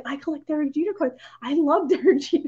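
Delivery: lightly hammed-up fangirl; gushing fan. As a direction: blurt it out like a starry-eyed fan. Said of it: "But no, I was like".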